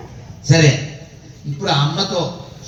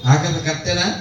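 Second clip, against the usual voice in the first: normal talking voice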